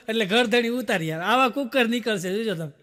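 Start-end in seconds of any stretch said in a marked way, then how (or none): none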